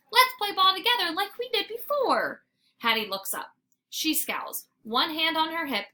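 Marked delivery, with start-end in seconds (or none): 0.1-2.4 whine. Acted. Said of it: "I whine".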